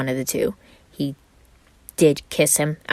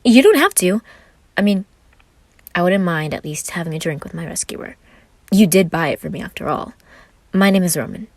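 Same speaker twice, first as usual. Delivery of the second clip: Roman, bright and flirty; 3 semitones higher, 5 dB louder than usual. Acted. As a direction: play the small charming Roman. Roman's lines, higher voice.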